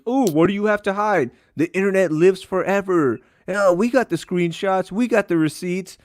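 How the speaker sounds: high, taunting mimicry of a smug pundit; mocking pundit voice. Mocking pundits demanding receipts.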